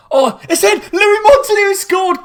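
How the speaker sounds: screaming high and loud with joy; ecstatic goal scream. Real, not acted. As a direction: explode as the goal goes in. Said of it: "we're way too excited".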